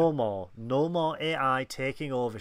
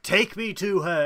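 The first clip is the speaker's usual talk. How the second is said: loud, raised-pitch command; mock demand; 6 semitones higher, 8 dB louder than usual. Podcaster voicing frustrated villain to underling, loud mock demand. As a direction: demand it, imitating an impatient villain.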